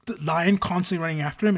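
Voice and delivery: ordinary talk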